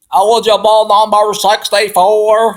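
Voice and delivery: mock the referee's botched Southern accent; referee's butchered accent. Football fan mocking how a referee spoke, loud exaggerated accent. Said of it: "However the hell he spoke".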